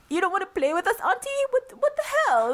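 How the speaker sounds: high mock-child indignation; offended kids. Aunt imitates kids feeling snubbed by her, high indignation. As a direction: mimic a put-out child, voice way up. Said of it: "It was like".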